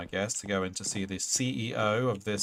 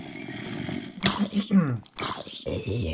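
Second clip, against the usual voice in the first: throat clearing; 3 semitones higher, 4 dB louder than usual. Real, not acted. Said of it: "Why am I losing my voice?".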